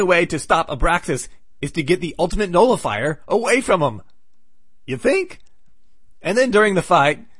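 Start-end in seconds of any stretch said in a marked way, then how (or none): 4.9-5.4 sarcastic. Real, not acted. Sarcastic aside on obvious plan.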